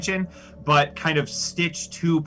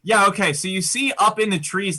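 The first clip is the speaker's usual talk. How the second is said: usual speaking voice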